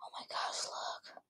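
gasp, then whisper in wonder; a hushed, amazed whisper; awed whisper. Real, not acted. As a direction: whisper in awe. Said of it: "gasp at fireworks toy".

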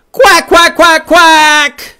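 rapid volley of loud, high quacks; mad duck. Acted. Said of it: "That duck is sure mad".

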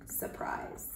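unvoiced whispered word. A narrator's reading. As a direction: whisper it for effect. Whispered ending.

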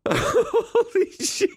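slow, high, laugh-strangled holy shit; can barely get it out for laughing. Real, not acted. Friend helpless at chair mishap.

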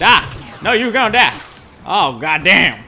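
loud angry yelling at the screen; raging gamer. Real, not acted. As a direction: scream at the enemy player. Firefight, yelling at enemy.

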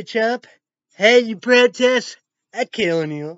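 loud, high mock shouted threat. Retelling a threat to Trump, mock shouted. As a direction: bark it like a menacing caller.

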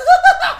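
high, loud gloating cackle; mocking laugh. Real, not acted. Prankster hearing threats to report him, mocking laugh. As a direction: burst out laughing at their outrage. Troll laughs after winning.

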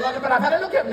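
normal talking voice

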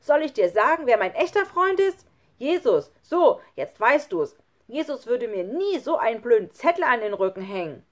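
loud, high, triumphant rant; angry scolding. A narrator's reading. Lukas holds up Jesus against Schlunz, angry. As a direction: hurl it at him defiantly. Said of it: "schimpfte Lukas".

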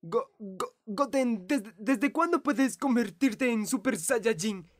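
stuttering start, then a rushed astonished question; stunned stammer. Acted. Older brother stunned by the boy's transformation, stammering. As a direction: stumble on the first words in shock.

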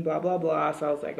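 ordinary delivery

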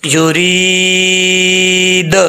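युरीद with the final द bounced, slow and sung; qalqala on final dal. Shown as a demonstration. Teacher demonstrates qalqala on युरीद, slowly sung. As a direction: end युरीद with an echoing द. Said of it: "यहाँ पे कलकला करेंगे".

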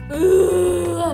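long, drawn-out, whiny groan; disgusted groan. Real, not acted. Tastes the highball.

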